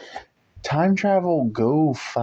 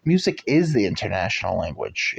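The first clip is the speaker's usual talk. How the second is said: usual speaking voice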